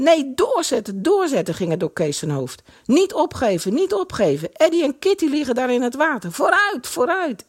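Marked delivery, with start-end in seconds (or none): 0.0-1.5 high, insistent urging; urgent repeated exhortation; urging himself on. A narrator's reading. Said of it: "daar hoorde hij duidelijk Eddy's stem". 2.8-7.4 high-pitched urgent exhortation; insistent, alarmed urging; urgent spurring. A narrator's reading. Kees driving himself on to rescue.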